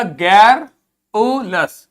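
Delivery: गैरुलस drawn out very slowly, each syllable held; slow model reading. Shown as a demonstration. Teacher reading a new word to pupils, very slow. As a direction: slowly spell गैरुलस out in sound. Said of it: "आगे देखो क्या लिखा".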